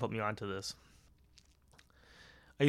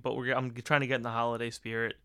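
ordinary talk

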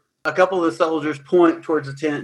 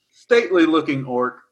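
ordinary talk, nothing marked

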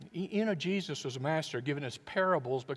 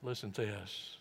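hushed, heartfelt, with long pauses; quiet, tender; 4 semitones lower, 7 dB quieter than usual. Acted. Preacher voicing Jesus to his disciples at supper, quiet and tender. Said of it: "You know what Jesus said?".

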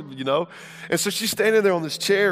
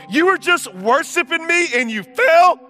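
loud, higher scolding dad; mock angry dad; 6 semitones higher, 5 dB louder, slower than usual. Pastor mocks the dad he wasn't, scolding his fallen toddler loudly. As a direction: scold like an unfair, self-centred father. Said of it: "this is not what I did".

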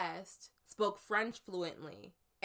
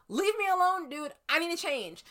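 shooing the driver; 9 semitones higher, 5 dB louder than usual. Retold or imitated.

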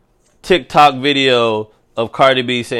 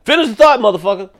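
higher, louder exasperated heckle; impatient listener; 8 semitones higher, 4 dB louder, much faster than usual. Retold or imitated. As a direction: snap at the host to get on with it. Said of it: "somebody's listening to this shit like".

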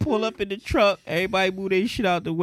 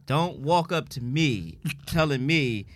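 normal talking voice

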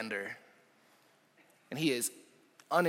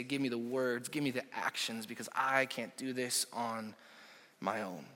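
much softer, low and intimate; soft prayer; 3 semitones lower, 9 dB quieter than usual. Shown as a demonstration. Pastor modelling a humble prayer for the church, softly. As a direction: pray it quietly, almost whispered.

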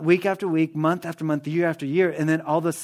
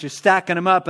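usual speaking voice

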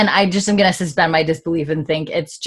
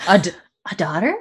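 hesitant restart, DAUGHTER lifted into a question; questioning intonation; faster than usual. Shown as a demonstration. Host tries out the subtitle's intonation, questioning. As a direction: test the question tune on DAUGHTER.

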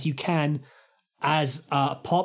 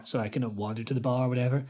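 normal talking voice

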